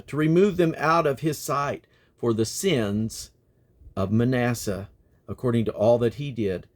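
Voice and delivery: measured, declaiming reading; scripture reading. Acted. Reader to listeners, measured solemn reading. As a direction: read the list of enemies with weight.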